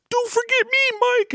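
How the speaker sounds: very high, slow put-on toy voice; Steve the hippo voice. Dad imitating daughter voicing her hippo, very high and slow. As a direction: squeak it out like a stuffed hippo. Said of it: "she'd do the voice".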